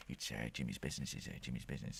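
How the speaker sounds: very low, soft impression of an actor; intense close-mic mumble. Mocking the actor's intense close-up moment, low and soft. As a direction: imitate an intense film gangster right on the mic. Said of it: "those intense close to the mic thing".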